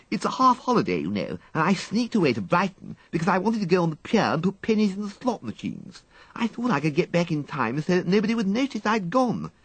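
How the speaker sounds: a cheeky schoolgirl owning up to mischief; schoolgirl Peggy. Acted. Peggy explains her escape.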